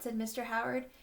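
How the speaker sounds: ordinary delivery, nothing marked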